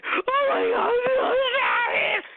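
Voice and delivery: high, loud mock cry; hammed-up shocked scream. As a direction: shriek in fake alarm. Imagined kid screaming at show.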